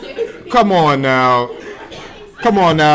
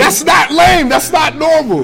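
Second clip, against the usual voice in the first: shouting; 9 semitones higher than usual. Real, not acted. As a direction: yell it in disbelief. Arguing about a scary villain.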